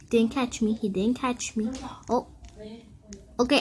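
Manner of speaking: ordinary talk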